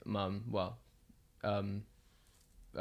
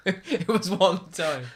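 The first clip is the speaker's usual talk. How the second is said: high, loud, drawn-out laughing protest; squealed, stretched-out defence with laughter; 8 semitones higher, 7 dB louder, much slower than usual. Real, not acted. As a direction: protest slowly through laughter, pitched way up. Protests amid laughter over teasing.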